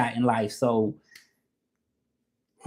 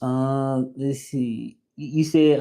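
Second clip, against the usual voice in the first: ordinary delivery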